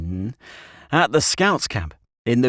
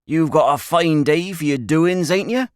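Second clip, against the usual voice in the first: loud, higher rustic greeting; old country shepherd; 8 semitones higher, 4 dB louder than usual. A narrator's reading. Shepherd coming up behind the boys, hearty rustic greeting. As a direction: hail them like an old shepherd.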